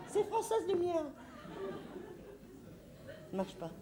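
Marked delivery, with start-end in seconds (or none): 0.1-1.0 bright phone greeting. Acted. Announcing herself on the phone.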